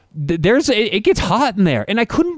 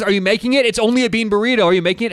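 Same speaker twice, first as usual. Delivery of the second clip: impatient mock barking, pitched higher; barking impatiently; 6 semitones higher, faster than usual. Imitating Karen barking at the cook, impatient and higher. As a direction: hurry the cook up in a mock bark. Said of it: "she starts barking at him".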